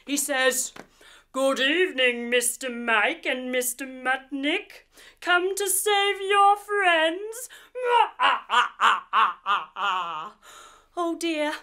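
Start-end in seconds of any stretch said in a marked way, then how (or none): 1.3-7.5 a gloating alien taunting the heroes; evil alien mudfish. Acted. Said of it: "the evil alien mudfish". 7.7-10.3 a louder gloating cackle in a strange voice; evil villain laugh. Acted. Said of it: "the evil alien mudfish".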